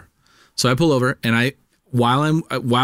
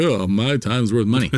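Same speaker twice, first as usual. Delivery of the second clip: mock douchebag. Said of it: "I felt like a fucking douchebag".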